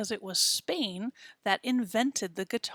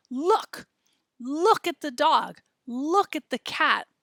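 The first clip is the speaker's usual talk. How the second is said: higher, louder call to everyone; 6 semitones higher, 5 dB louder, slower than usual. Retold or imitated. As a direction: call out to the whole room. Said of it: "she's just saying".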